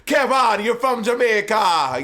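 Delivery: loud, high, drawn-out mock Jamaican accent. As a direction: tease with a big, slow Jamaican accent. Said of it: "when you repeatedly mock me".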